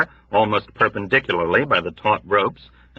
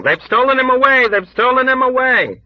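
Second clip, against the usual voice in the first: high, loud, crowd-like screams; loud panicked chorus; screaming; 5 dB louder than usual. Acted. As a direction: scream it with the ward. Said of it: "people all over the ward began screaming".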